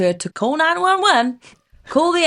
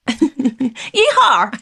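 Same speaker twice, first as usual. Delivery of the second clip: mock American accent; 4 dB louder than usual. Joking on requested American accent.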